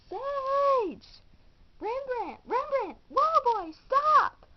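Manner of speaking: loud, high frantic shouting; calling out. Acted. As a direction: yell after the runaway dog. Chasing runaway dog, calling out.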